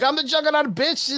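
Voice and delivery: high-pitched, hammed-up battle boast; Juggernaut. Juggernaut announcing himself to the Hulk, mock battle boast. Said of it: "the Juggernaut's like".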